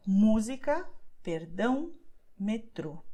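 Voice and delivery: música stressed on mú, perdão on dão, metrô on trô; stress on the accent. Shown as a demonstration. Teacher showing learners where the stress falls. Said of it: "stress that sound".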